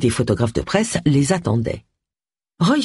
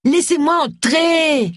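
high, drawn-out plea; high-pitched begging cry; Roy Silver; 12 semitones higher, slower than usual. A narrator's reading. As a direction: call slowly and high through the letterbox. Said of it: "la voix de Roy se fit entendre".

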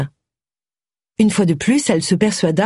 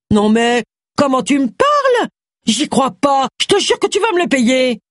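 indignant anger; 8 semitones higher than usual. A narrator's reading. Husband furious at insult.

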